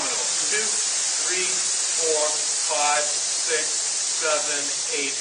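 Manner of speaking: counting through a loud hiss; hissing counts. Shown as a demonstration. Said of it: "And hiss".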